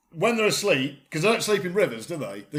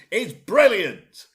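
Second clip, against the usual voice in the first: slow exaggerated enthusiasm; 3 semitones higher, 6 dB louder, much slower than usual. Real, not acted. Ironic cheer about Skegness.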